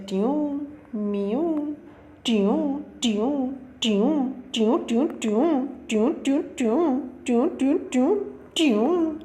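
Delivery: high, swooping "tyoon" sounds in a repeated run; imitated winding-river noise. Shown as a demonstration. Teacher showing students how a river meanders, playful high swooping noises. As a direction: trace each bend with a high vocal swoop.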